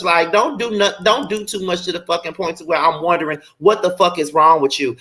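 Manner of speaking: ordinary talk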